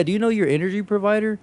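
salesman voice. Retold or imitated. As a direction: sell it like a chirpy canvasser. Imitating energy salesman.

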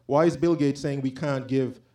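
usual speaking voice

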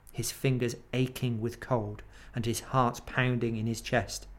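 ordinary delivery

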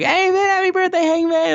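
very high, cheery mock greeting; Dark Order. Friends barging in with birthday wishes, high put-on voice. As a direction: squeal a birthday hello in a put-on voice.

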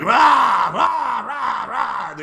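loud, high mock screams; imitated crazed screaming. Inmates screaming in jail isolation, imitated high shouting. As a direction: yell wordless rahs like crazy inmates. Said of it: "all these crazy people scream".

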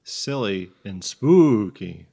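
slow, with "spooky" drawn out; deliberate phrase, lingering on "spooky"; stress on spooky. Shown as a demonstration. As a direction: stretch the first vowel of "spooky". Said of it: "It's just the emphasis changes".

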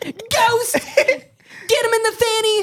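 very high mock soldier's order; Captain Price. Calling to Ghost as a game captain, high mock order. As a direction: squeal out a hammed-up battlefield command. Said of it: "Ooh, Captain Price?".

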